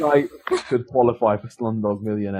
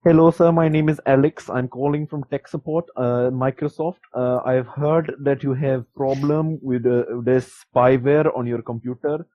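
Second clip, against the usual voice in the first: lightly voiced Indian tech caller; a hint of an Indian call-centre accent. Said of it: "they called me, it's like".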